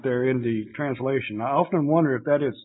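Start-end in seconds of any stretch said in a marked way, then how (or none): none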